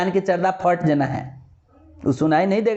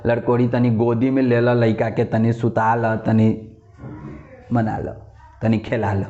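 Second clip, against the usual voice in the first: low-pitched voice of superstitious villagers; imitated village folk; 9 semitones lower than usual. Villagers advising on a crying baby, low imitated folk voice. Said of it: "तो लोग कहता है कि".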